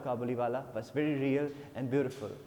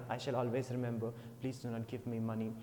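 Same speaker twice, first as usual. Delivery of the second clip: humble soft plea; 5 semitones lower, 6 dB quieter than usual. Acted. Kabuliwala refuses money humbly.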